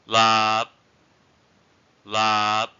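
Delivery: "lap" held long and loud on a level tone; long vowel. Shown as a demonstration. Teacher giving a long-vowel example, drawn-out "lap". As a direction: stretch the vowel of "lap", don't release the end. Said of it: "examples with long vowels".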